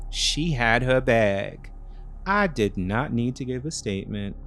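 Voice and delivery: plain reading voice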